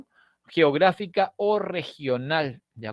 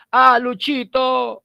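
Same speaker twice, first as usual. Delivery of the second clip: loud, higher "¡Ah, Luchito!" called out slow; affectionate call; 8 semitones higher, 7 dB louder, slower than usual. Retold or imitated. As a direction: call out to a friend fondly. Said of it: "usamos permanentemente diminutivos". (llamando a Luchito con cariño)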